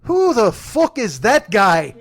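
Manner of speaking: slow, loud mock Irish drawl with a laugh in it; McGregor put-down. Teller imitates McGregor dismissing a heckler, slow and loud. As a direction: drag out the dismissive question. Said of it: "Conor McGregor just goes".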